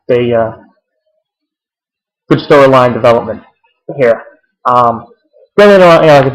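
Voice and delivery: normal talking voice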